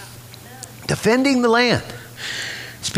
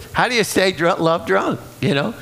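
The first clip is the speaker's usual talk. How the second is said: ordinary talk, nothing marked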